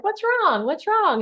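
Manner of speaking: high-pitched, fussing "what's the matter" voice; mock concerned friends. Girlfriends fussing over the pouting dieter, high mock concern. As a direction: imitate friends asking in a high, cooing rise and fall.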